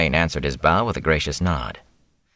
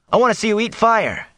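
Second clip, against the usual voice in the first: excited shout. A narrator's reading. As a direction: yell it from the crowd. Mat keeps shouting.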